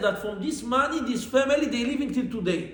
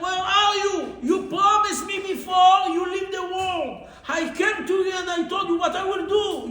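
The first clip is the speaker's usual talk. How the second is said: crying out to husband; 7 semitones higher than usual. Retold or imitated. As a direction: cry to the dead husband, hurt and pleading. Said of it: "she started to cry to her husband".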